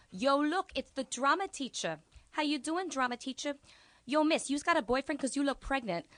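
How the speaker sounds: put-on high, loud street-kid swagger; tough teen student. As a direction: talk like a cocky Bronx teenager. Voicing the student Lejean.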